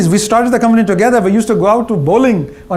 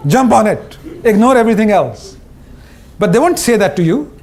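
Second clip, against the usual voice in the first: ordinary talk, nothing marked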